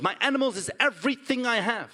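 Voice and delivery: ordinary delivery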